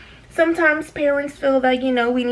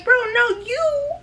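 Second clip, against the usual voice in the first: high exasperated inner voice; exasperated inner rant; 11 semitones higher than usual. Retold or imitated. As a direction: scold him in your head, pitched way up. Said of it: "thinking in my head".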